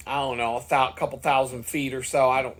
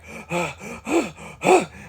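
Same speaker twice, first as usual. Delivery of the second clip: gasping for air mid-ride; gasping while pedaling hard; heavy gasping; 4 semitones higher than usual. Retold or imitated. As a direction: gulp air like a winded cyclist. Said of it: "starting to breathe heavy".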